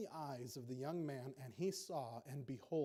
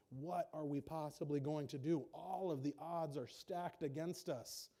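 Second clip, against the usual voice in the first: usual speaking voice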